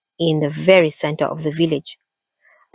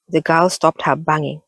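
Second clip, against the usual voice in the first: plain reading voice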